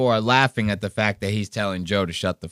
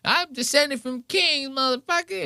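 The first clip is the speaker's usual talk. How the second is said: mocking repeat. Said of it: "You just repeated the same".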